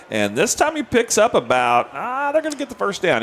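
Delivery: high excited call; excited play-by-play. Real, not acted. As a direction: call the first down as it happens.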